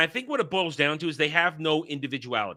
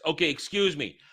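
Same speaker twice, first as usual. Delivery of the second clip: normal talking voice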